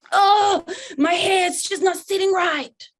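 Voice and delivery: put-on high, loud, slow teen whine; imitating a daughter's hair complaint; moody teen daughter. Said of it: "my daughter ... said to me".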